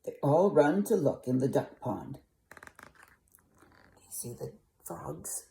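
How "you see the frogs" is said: whisper. Real, not acted. Whispered aside to viewers.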